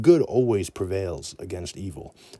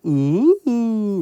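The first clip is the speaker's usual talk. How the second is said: high cooing ooh from the crowd; audience ooh; 13 semitones higher, 7 dB louder than usual. Retold or imitated. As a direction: coo like a charmed audience. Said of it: "And then the audience goes".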